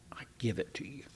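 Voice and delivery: hushed, voiceless surrender; whispered prayer. Acted. Preacher ends his prayer to God in a whisper. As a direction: breathe it out as a whisper.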